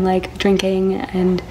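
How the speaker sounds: ordinary talk, nothing marked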